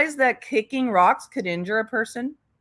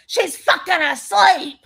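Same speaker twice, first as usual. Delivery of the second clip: higher, louder mock mother; mock new mom; 6 semitones higher, 5 dB louder than usual. Picturing herself as a new mom, mock loud blurt. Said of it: "a mom of a new baby going".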